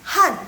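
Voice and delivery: Han loud with sharp falling tone; tone B demo. Shown as a demonstration. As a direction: snap Han down hard. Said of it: "Yang B, yang B".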